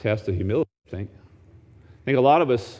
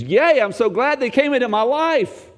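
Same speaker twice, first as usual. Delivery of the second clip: joyful exclamation; 11 semitones higher than usual. Acted. Voicing Paul's glad boast.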